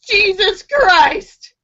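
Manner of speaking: high, slow, loud proclamation; hammed-up drunken boast, stretched out; drunk man. Drunk man declares himself to the first priest, grandly. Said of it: "a drunk man comes up to them".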